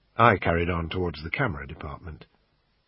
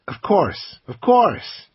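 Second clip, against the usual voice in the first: a higher, louder American salesman pitching the camera; pawn shop salesman; 11 semitones higher, 5 dB louder, much slower than usual. Acted. Assistant pitching the camera to the customer, salesman voice. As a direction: sell it like a pushy shop assistant.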